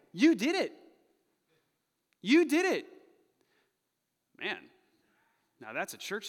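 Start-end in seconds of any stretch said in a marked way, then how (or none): 0.1-2.8 high, forceful preacher's accusation; accusing shout. Acted. Peter confronts the crowd, loud high accusation.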